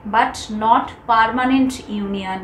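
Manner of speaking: permanent slowed right down and leaned on, word by word; stress on permanent. Shown as a demonstration. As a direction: drag out permanent to make it land. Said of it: "permanent এই ওয়ার্ডটার উপরে আমি জোর দেব". (permanent শব্দে জোর দিয়ে, খুব ধীরে)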